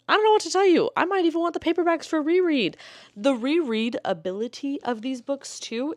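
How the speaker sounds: high, excited squeal; giddy gush. Real, not acted. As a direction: squeal it out in fan delight.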